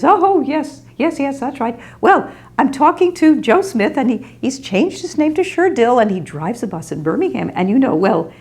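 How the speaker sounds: high, flustered, rushing voice; flustered old lady. Retold or imitated. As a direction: imitate a frazzled elderly woman hurrying off the phone. Kitty answering Jane.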